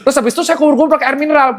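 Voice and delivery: fast, high cheeky patient; mock naughty patient. Naughty patient piles on excuses to the doctor, mock cheeky. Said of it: "ini kan ada yang nakal".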